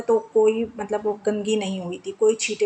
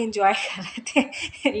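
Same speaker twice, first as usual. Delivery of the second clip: slow, giggling through the line; can't hold back a laugh; amused giggle mid-sentence; laughing through words; much slower than usual. Real, not acted. Laughs at daughter's tooth breaking.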